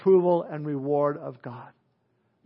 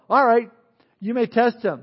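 raised divine voice; 5 semitones higher, 5 dB louder than usual. Acted. God answers the devil's challenge.

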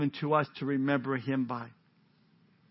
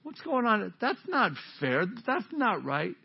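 complaining reapers; 5 semitones higher, 3 dB quieter than usual. Acted. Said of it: "the other reapers might have said".

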